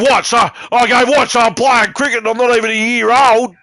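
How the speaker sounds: can't believe what the game says; mock outrage, high and loud; incredulous. Retelling his shocked reaction.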